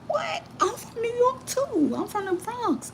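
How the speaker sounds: excited high surprise. Retold or imitated. Telling the man she is from the Bronx, excited. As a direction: gush with surprise at a fellow New Yorker. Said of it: "I was like".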